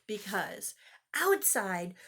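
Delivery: usual speaking voice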